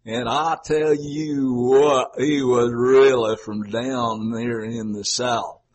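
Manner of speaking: slow, stretched Southern drawl; Southern accent. Retold or imitated. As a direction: imitate a Southerner, drawing out every vowel. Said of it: "it was a Southerner".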